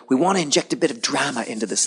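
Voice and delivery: lightly put-on British accent. Executive producer pitches drama to the host, light British accent. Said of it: "she had a British accent".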